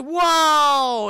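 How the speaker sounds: long, high, loud exclamation; hammed-up wonder; mock amazement. Max amazed by happy family.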